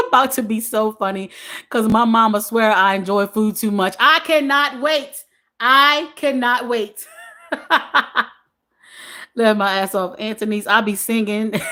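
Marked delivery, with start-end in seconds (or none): 4.0-7.1 very slow, each word stretched; drawn-out anticipation. Real, not acted. Host relishing a coming live, drawn-out anticipation. Reads eager comment with relish. 7.5-8.2 short burst of laughter; amused chuckle. Real, not acted. Host cannot wait for funny live, laughing.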